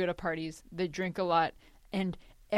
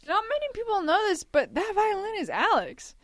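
mock reveal; 12 semitones higher than usual. Joking announcement.

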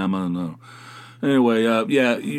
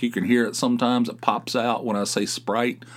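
usual speaking voice

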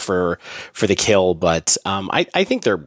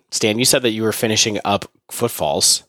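usual speaking voice

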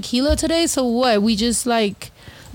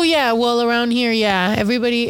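ordinary talk, nothing marked

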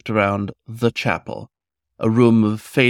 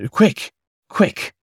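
urgent cry; 12 semitones higher, 4 dB louder than usual. A narrator's reading. Hook urging the fox to flee.

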